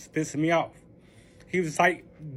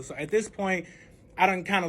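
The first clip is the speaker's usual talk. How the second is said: usual speaking voice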